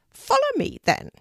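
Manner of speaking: high, loud bird voice; an urgent talking raven; unusual raven voice. A narrator's reading. Raven Harris leads.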